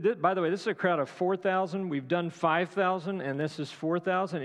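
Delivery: ordinary delivery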